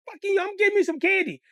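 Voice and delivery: put-on high, loud kid insisting; whiny demand. Little kid defying big sister, high loud whine. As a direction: stamp your foot for the candy.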